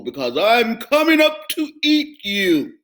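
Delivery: loud, booming troll roar; troll roaring at the goat. Acted. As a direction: roar him down. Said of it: "roared the troll".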